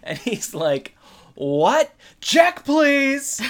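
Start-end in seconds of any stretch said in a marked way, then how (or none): 1.3-3.4 much higher and louder, startled; alarmed disbelief. Retold or imitated. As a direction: flag down the waiter in panic. Corey wants to escape Topanga.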